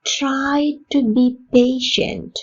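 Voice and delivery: slow, careful teacher model read; slow model sentence. Shown as a demonstration. Teacher modelling a sentence for Tamil learners, slow and clear. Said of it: "model sentence repeated slowly".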